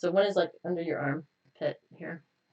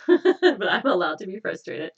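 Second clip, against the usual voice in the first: real laughter breaking into the affirmation, louder; laughing through it; 3 semitones higher, 8 dB louder than usual. Real, not acted. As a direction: try to say it but keep giggling. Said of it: "see, I'm, I'm laughing".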